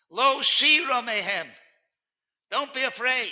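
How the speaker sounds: loud, rising preacher's build; rousing sermon. Acted. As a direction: stir the congregation with each word.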